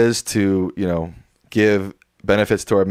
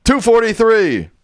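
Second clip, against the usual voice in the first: excited; 13 semitones higher, 4 dB louder than usual. Real, not acted. Counting up the membership total.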